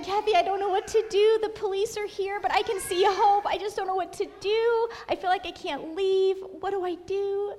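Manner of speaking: worked-up, helpless pleading; retold panic. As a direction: replay a frantic phone call. Retold panicked call to friend.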